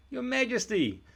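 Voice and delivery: high, eager address to the king; a cook's high, bright hail. A narrator's reading. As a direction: call up to His Majesty, keen to please. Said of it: "the chief in charge of fish would always say".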